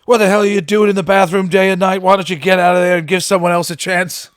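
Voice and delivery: high, loud, fast, exasperated bellow; a father shouting through the bathroom door at his kid, loud and high; dad yelling. Retold or imitated. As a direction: blast it like a fed-up father banging on the bathroom door. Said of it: "I'll never forget my old dad".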